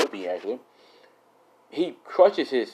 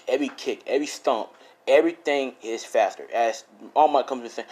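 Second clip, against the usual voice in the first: ordinary delivery, nothing marked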